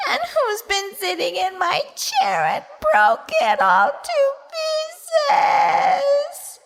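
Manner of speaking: high, slow wailing cry, rising to a shout; Baby Bear crying. A narrator's reading. Baby Bear finds his broken chair, wailing and crying. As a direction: sob it out like a broken-hearted cub. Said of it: "cried Baby Bear as he wept".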